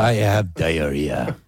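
put-on slow, drawn-out French accent. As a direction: echo the player in a French accent. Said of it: "it's so romantic, the language".

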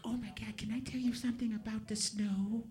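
put-on high, soft girly voice; imitated girlfriend. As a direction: gush softly in falsetto. Imitating girlfriend gushing about snow.

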